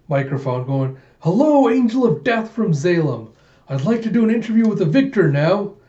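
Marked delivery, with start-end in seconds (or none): 1.2-5.7 high, loud showman's greeting; excited interviewer. Retold or imitated. Buick with microphone greeting the victor, excited interviewer voice. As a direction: hail the champion like an eager reporter.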